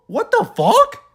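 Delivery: real shocked cry; shocked yelp. Real, not acted. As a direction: yelp it in disbelief. Recapping announcements in shock.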